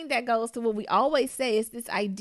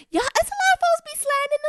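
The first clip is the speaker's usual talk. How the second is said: high excited exclamation; 4 dB louder than usual. Real, not acted. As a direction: pitch jumps way up, exasperated.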